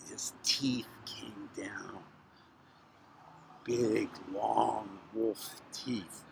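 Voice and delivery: slow, low near-whisper; hushed suspense. A narrator's reading. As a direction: draw it out softly and ominously. Suspense before the teeth reveal.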